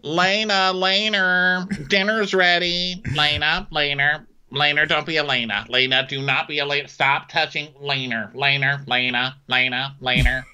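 put-on high mom calling the kids in; calling kids to dinner. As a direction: scold and call the twins like a mom.

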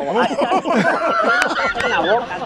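laughing while teasing. Real, not acted. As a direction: tease him through a laugh. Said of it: "no lo deja pronunciar bien".

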